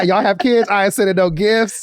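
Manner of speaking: loud, high, petty declaration; hammed-up petty refusal; sassy petty rant. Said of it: "I'd be like".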